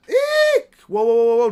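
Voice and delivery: high squeal into loud whoas; shriek, then flail for control; acted alarm, very high and loud; panicked.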